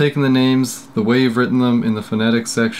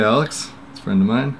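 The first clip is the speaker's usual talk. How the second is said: ordinary talk, nothing marked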